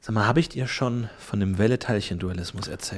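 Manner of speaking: low, soft nerdy professor; low Werner voice. Acted. Werner boring Nils with physics, low and soft. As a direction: drone on like an eager old physicist.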